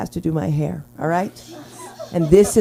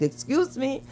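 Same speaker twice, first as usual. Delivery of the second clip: a lightly put-on Puerto Rican accent, higher pitch; mock Puerto Rican; 7 semitones higher, much slower than usual. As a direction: snap "muévete" like a New Yorker. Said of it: "I'll be like".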